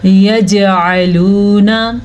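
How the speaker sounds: slow यजअलूना with a bounced j; qalqalah recitation. Shown as a demonstration. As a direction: jolt the j, then stretch the oo of यजअलूना. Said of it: "जीम के ऊपर भी कलकला".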